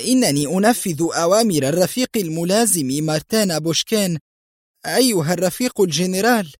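high-pitched, alarmed self-defence. A narrator's reading. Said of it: "وشحب وجه فولغا". (تبرير بنبرة عالية مذعورة)

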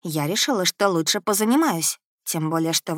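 ordinary delivery, nothing marked

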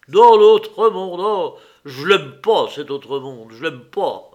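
loud outburst sinking to a mutter; haggard dismay. A narrator's reading. Nicolas repeats his brother's words about the other world, aghast. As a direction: recoil loudly, then let it drop away. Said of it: "répéta Nicolas en fixant des yeux hagards".

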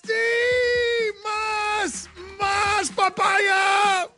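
very high, long-held cries; hammed-up excitement. Radio host egging on the Spanish clip, high mock-excited cries. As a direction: howl each word out in mock ecstasy.

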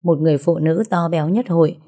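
ordinary delivery, nothing marked

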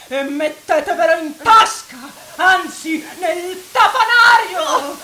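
mocking furious shout. Young man taunting the priest, furious. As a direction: spit the insult out.

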